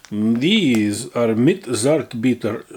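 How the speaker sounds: "mit Zartbitter" read out slowly in a German style; reading German label. Shown as a demonstration. Snacker reading German off a cookie pack, slow careful German pronunciation. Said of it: "I don't know what accent this is".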